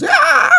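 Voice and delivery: high "aah" aping the announcer; mimicked yell. Streamer mimicking the excited announcer, high mock yell. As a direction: imitate the announcer's high-pitched cry. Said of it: "He goes".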